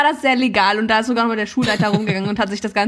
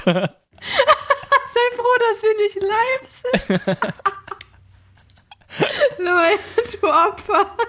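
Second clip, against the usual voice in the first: high, giggling words through laughter; laughing while teasing; 11 semitones higher, slower than usual. Real, not acted. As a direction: tease him while cracking up. Teasing through laughter after slip.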